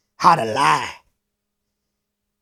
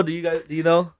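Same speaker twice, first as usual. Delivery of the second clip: ordinary delivery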